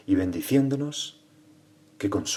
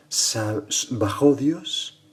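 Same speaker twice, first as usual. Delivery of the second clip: very slow, pitched up with a rise; weighty maxim; 4 semitones higher, 3 dB louder, much slower than usual. Acted. Preacher explaining San Ambrosio's maxim, slow raised first half. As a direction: stretch it out and leave it lifted.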